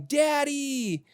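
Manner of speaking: put-on high, thrilled little-kid cry of Daddy; child's excited Daddy call; excited child's yell. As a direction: call out like a toddler greeting dad. Kids sprinting to door yelling.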